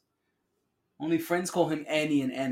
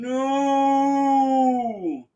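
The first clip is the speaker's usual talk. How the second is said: long, high, loud wail of NO; hammed-up villain's anguished scream; dramatic mock scream; 9 semitones higher, 10 dB louder than usual.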